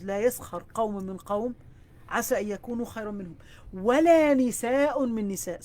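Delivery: quick, chanted verse recitation; Quran recitation. Acted. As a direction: recite the verse in one rapid flowing breath.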